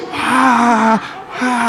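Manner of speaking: high drawn-out mock "ah" cries; imitated pain wail. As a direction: wail as if badly hurt, hammed-up. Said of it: "I've been hurt pretty bad".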